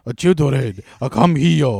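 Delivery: put-on loud, high caricatured Japanese accent; hammed-up Japanese-accented old master; mock Japanese accent. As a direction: bark it in an exaggerated fake Japanese accent. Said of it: "an impression of his racist accent".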